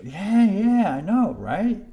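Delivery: high, loud, eager agreement; excited agreement. Real, not acted. Streamer agreeing with a viewer's dream, excited and high. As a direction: agree eagerly, pitch swooping up.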